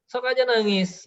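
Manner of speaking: mock-dismissive retort, pitched high; dismissive parent. Parent to a crying child, dismissive, high-pitched. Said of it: "Kita terlalu cuek gitu ya".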